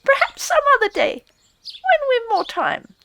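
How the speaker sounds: high squeaky polite refusal; hurried field mouse. A narrator's reading. Field mouse hastily turning Rat down, high and squeaky. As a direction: decline fast in a tiny high voice.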